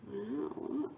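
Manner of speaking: ordinary delivery, nothing marked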